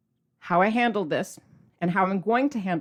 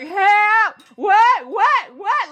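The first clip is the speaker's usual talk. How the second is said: high, loud dramatic shriek; mock panicked scream; 11 semitones higher, 10 dB louder, much slower than usual. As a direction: scream in mock horror at the news. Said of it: "so dramatic with my screaming".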